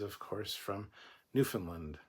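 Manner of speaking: ordinary talk, nothing marked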